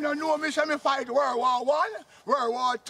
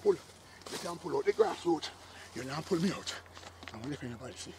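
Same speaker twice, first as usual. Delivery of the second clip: much softer, strained voice while wrestling a goat; straining effort; 8 semitones lower, 13 dB quieter than usual. Acted. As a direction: grunt the words out under effort. Uncle strains against a pulling goat.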